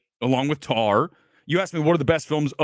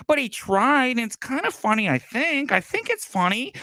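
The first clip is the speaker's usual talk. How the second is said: high, wishy-washy mimicry; high-pitched parody of a lenient fan; mocking apologist; 7 semitones higher than usual. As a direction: make feeble excuses in a raised mock voice.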